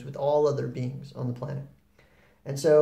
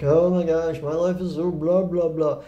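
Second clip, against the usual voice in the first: louder mock moaning of a downer; hammed-up complaining, imitating a killjoy; total downer; 3 semitones higher, 6 dB louder than usual. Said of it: "like a total downer".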